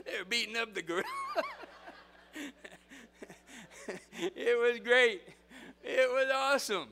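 high, loud, laughter-filled delivery; real laughing. Real, not acted. Preacher relishing gorilla's beating, helpless laughing. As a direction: crack up while telling it. Cracking up at his own story.

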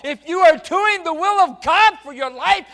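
very loud, high, shouted preaching; full-force preaching. Acted. Preacher proclaiming the resurrection, shouting at full force. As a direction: climax of the sermon, preach it out.

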